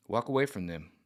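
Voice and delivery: usual speaking voice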